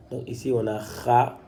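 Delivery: ordinary talk, nothing marked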